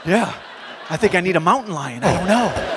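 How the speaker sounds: very slow, offhand, laughing through it; deadpan reply. Retold or imitated. Asked about the cheetah, he answers in a slow deadpan. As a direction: shrug it off slowly as if it's normal.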